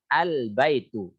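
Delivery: al-baitu said slowly, lam sukun; slow al-baitu demo. Shown as a demonstration. Teacher practising al-qamariyah example for students, slow al-baitu. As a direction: spell al-baitu out slowly for the class. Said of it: "prakteknya seperti ini".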